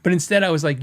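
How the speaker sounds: ordinary talk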